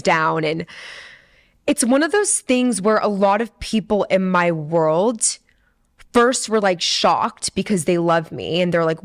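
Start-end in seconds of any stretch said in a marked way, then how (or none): none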